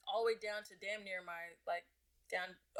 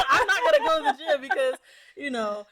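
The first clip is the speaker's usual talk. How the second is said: laughing through the words; giggling while talking; 7 semitones higher, 18 dB louder than usual. Real, not acted. Laughing at her own joke.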